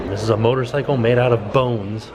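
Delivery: normal talking voice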